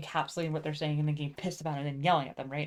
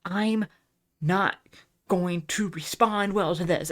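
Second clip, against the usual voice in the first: each word set apart, louder, with pauses; emphatic warning; 5 dB louder, slower than usual. Retold or imitated. Warning friends for the umpteenth time, slow emphatic warning. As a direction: spell it out forcefully, word by word. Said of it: "I tell them so many fucking times".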